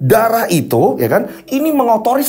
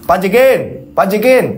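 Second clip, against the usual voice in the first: calling out. Acted. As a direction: call out for the office boy. Bandi calling the office boy.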